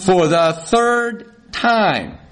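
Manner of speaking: very slow, exasperated retort; acted husband at the end of his patience; incendiary. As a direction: drag out each word in mock irritation. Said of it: "here's where the incendiary thing can start".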